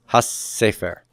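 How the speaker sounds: "ha sefer" with a long double s; ha sefer run together as has-sefer; doubled consonant. Shown as a demonstration. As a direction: hold the s joining ha to sefer. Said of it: "add the Dagesh forte".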